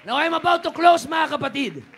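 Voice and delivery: loud, high-pitched sermon climax; fiery preaching. Real, not acted. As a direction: preach it with full force. (mainit na pangangaral)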